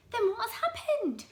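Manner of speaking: put-on high, anxious old wife; Mrs Twit crying out. Wife pleading with her husband, high and anxious. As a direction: cry out high and worried. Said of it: "cried Missus Twit".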